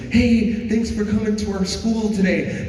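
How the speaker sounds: put-on high, bright teen greeting; Teresa's voice. Teller voicing Teresa greeting the visitors, high and bright. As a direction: pitch it up for the girl.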